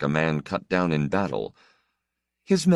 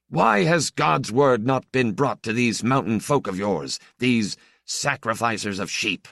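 haughty stranger priest; 7 semitones higher than usual. Acted. Stranger priest to the old priest, haughty. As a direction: question them like a proud churchman.

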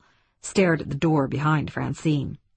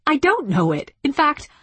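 higher-pitched angry rebuttal; indignant, raised-pitch insistence; sudden anger; 8 semitones higher than usual. A narrator's reading. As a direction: contradict him with a flash of anger. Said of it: "She spoke in sudden anger".

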